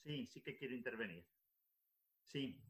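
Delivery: faint and distant, far from the mic. Real, not acted. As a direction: speak from far off the microphone. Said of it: "se te oye muy bajito".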